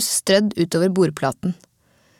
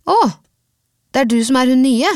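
exclaiming; 5 semitones higher, 4 dB louder, faster than usual. A narrator's reading. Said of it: "utbrøt Milla".

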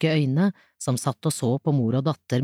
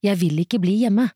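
mild anger in a higher voice; understated, higher-pitched angry refusal; 5 semitones higher, 3 dB louder than usual. A narrator's reading. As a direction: protest with a touch of anger. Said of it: "sa hun sint".

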